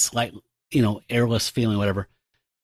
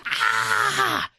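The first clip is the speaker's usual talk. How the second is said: high, sustained mock scream; acted-out shriek of panic; panicked scream. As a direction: scream in horror, high and held. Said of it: "she just panics, goes".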